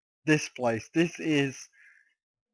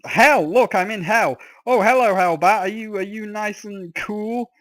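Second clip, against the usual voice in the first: loud, high, playful cry; excited exclamation; 7 semitones higher, 6 dB louder than usual. Real, not acted. Greeting a Hellbat in the game, loud and playful. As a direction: burst out at the game scene.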